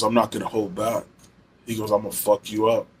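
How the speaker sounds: quietly menacing, imitating him; low-key threat. Retelling friend's threat before sparring.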